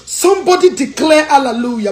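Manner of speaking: loud church call and response; rally the crowd like a preacher; preacher's call. Real, not acted. Host calling on listeners, loud preacher-style hallelujah.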